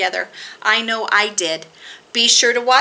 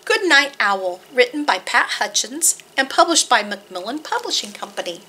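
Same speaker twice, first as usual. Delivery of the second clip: ordinary delivery, nothing marked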